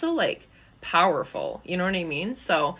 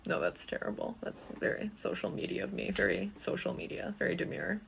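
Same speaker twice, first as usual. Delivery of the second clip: whispered, much softer self-mocking comment; hushed aside; 9 dB quieter than usual. Real, not acted. Host teasing herself about her social media joke, in a hushed aside. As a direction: tuck it away under your breath as if caught out.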